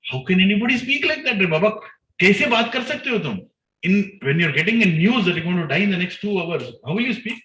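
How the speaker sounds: high, loud, astonished preaching burst; amazed exclamation. Real, not acted. Preacher marvels to the congregation at her calm, amazed and loud.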